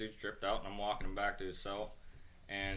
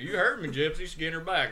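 higher, louder barked punchline; 7 semitones higher, 8 dB louder than usual. Retold or imitated. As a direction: bark the order at the inmate. Banjo's punchline repeated.

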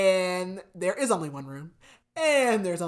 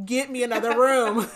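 loud, higher-pitched comic outburst; mock desperate demand; 3 semitones higher, 8 dB louder than usual. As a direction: blurt it out in hammed-up desperation. Acted desperate phone plea.

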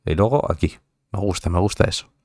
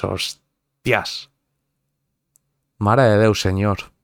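mock thick Catalan accent; playful Catalan accent impression; closed Catalan accent; slower than usual. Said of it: "Ese acento que es súper cerrado".

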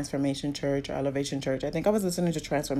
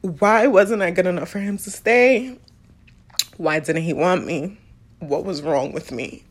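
louder, aching questions through tears; crying; 6 dB louder than usual. Real, not acted. Asking why her father didn't stay, crying openly. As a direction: cry it out, pleading. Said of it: "I'm not crying".

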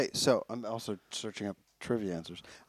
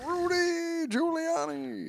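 Cosby impression; much slower than usual. Echoing the Cosby bit.

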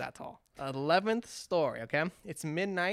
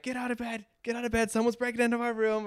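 higher, urgent mock panic to wake someone; panicked alarm; 6 semitones higher than usual. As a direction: hurry them out of bed in fright. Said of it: "Scared me".

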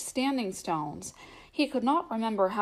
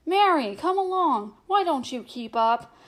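calling; 6 semitones higher, 3 dB louder than usual. A narrator's reading. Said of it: "he called".

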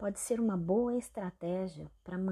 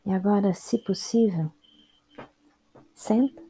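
unhurried meditation guide with long pauses; calm instructions in short, spaced-out phrases; guided meditation; 10 dB louder than usual. Real, not acted. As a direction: guide them through the body, leaving space after each instruction.

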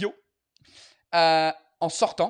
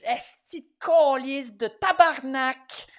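slow, higher Québécois curse string, relishing each word; mock Québécois rant; Québécois swearing; 7 semitones higher, 4 dB louder, slower than usual. Shown as a demonstration. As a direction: savour the Québécois swears like a local. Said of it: "j'aime bien juste".

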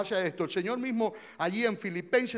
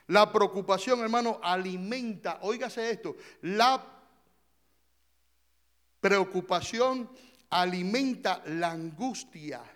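ordinary delivery

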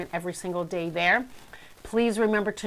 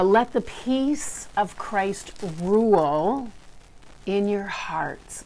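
slow, deliberate scripture reading; much slower than usual. Acted. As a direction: read the verse slowly and reverently. Reads scripture slowly.

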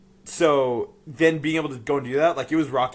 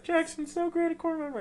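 imitating Alex. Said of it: "Alex, you're like".

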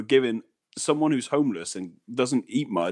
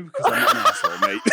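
high, loud laughing protest; 4 dB louder, faster than usual. Real, not acted. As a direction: break into laughter while speaking. Laughs while answering co-host.

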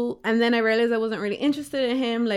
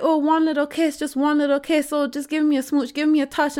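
retold wheedling; 4 semitones higher than usual. Man pestering her for a kiss.

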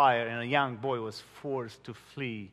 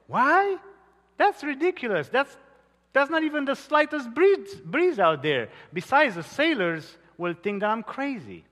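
an incredulous captain, much higher and louder; 9 semitones higher, 4 dB louder than usual. Acted. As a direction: scoff at the idea of setting sail. Captain scoffs at Taylor.

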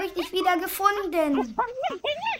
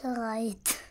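breathy, unvoiced whisper; 9 semitones lower, 11 dB quieter than usual. Accomplice whispers to the other that they are ready, whispering. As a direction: whisper the cue under your breath.

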